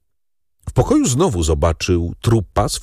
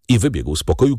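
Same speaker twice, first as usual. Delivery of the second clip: ordinary delivery, nothing marked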